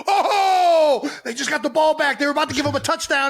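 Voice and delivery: high-pitched thrilled outburst, big "Oh" up front; excited fan reaction. Acted. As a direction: act out a fan's thrill at a sudden turnover. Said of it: "it's exciting".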